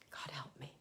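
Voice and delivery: very soft, low murmured prayer; quiet utterance. Shown as a demonstration. To moms, muttering a prayer softly. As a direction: barely say it. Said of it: "you utter those words".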